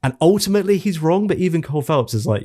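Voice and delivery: ordinary talk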